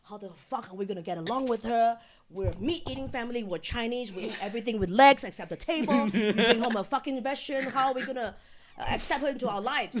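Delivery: loud, comic indignant rant; mom losing it. Retold or imitated. Mom fretting over a vegetarian joining the family, mock outraged rant. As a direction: imitate an appalled mother ranting, punching it out. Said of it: "she lost it".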